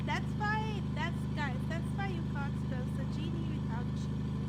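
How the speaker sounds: loud cocky bragging; proud boast. Real, not acted. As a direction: brag to the audience. Mock-proud boast to viewers.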